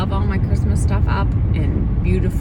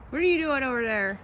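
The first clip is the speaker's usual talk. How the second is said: raised call across the yard; calling to the dog; 7 semitones higher, 10 dB quieter, faster than usual. Real, not acted.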